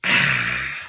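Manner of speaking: ordinary talk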